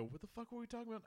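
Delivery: high, fast bewildered blurt; mock confusion. Host imitating themselves losing track, high fast blurt. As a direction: squeak it out lost. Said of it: "and go".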